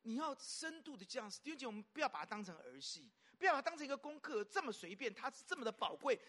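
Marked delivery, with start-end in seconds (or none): none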